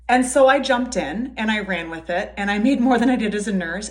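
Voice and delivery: ordinary delivery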